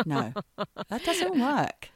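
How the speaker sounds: ordinary talk, nothing marked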